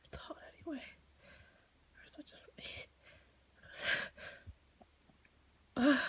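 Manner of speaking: hushed, anxious whisper to herself. Acted. Student at her desk whispering pep talk to herself, anxious whisper. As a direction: keep it breathy and secret, talking yourself through it.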